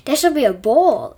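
loud, thrilled cry of joy; joyful exclamation. A narrator's reading. Brothers hearing about the royal ball, loud and joyful. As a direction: burst out with glee. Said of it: "stepbrothers exclaimed with joy".

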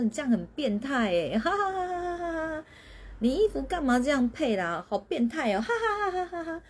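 high mocking laugh between the jabs; teasing with laughter. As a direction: tease a sibling and cackle at them. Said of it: "我们就说".

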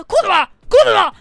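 loud, high sharp scold; impatient mother yelling; mom scolding. Retold or imitated. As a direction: bark it at the crying kid like a fed-up mom. Mom scolding crying child.

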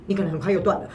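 normal talking voice

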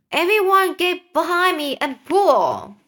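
high, rallying shout. Acted. Old grasshopper rallying everyone on the rope, shouted. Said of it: "shouted the old green grasshopper".

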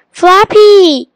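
loud, high yell of the name. A narrator's reading. Keeper yells as the bird takes Flappy away. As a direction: yell after someone carried off. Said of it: "yelled Keeper".

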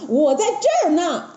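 high, lively call; Monkey King answering. Acted. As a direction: pipe up cheekily as the Monkey King. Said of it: "孙悟空啊回答".

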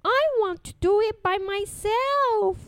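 put-on high, insistent child voice; a stubborn little girl insisting; little princess. A narrator's reading. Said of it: "said the little princess".

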